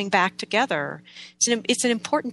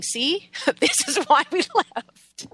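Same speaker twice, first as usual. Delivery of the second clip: laughing, higher-pitched and drawn out; amused ancestors' voice; 6 semitones higher, slower than usual. Acted. Speaking as ancestors to their descendant, amused and laughing. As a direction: say it slowly through a laugh, pitched up. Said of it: "felt my own ancestors saying".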